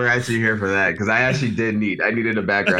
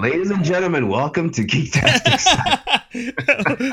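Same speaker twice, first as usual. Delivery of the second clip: announcer voice; 8 semitones higher, slower than usual. Pretend. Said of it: "I don't have enough bass".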